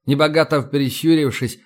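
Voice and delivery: ordinary delivery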